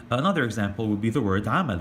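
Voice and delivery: ordinary talk, nothing marked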